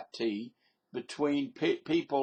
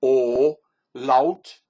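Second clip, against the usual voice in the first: O and laut shouted apart, much louder; word split demo; 9 dB louder than usual. Shown as a demonstration. Vlogger explaining umlaut to viewers, loud split O, laut. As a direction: break the word up and punch each part. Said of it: "Um means round".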